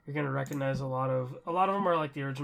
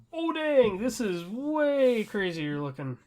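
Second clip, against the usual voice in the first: a higher, wowed gasp of a quote, slowing down at the end; retold amazement; 7 semitones higher, 5 dB louder, much slower than usual. Telling a co-host about first seeing the new Fortnite version, retold amazement. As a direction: relive being blown away by the new graphics. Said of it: "I was like".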